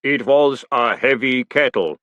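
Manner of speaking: very slow, measured golem voice; golem, slowly. Acted. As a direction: speak slow and weighty like clay. Golem voice held.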